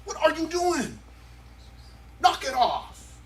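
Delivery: a father breaking up a fight; dad mode scolding. Retold or imitated. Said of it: "you go into dad mode".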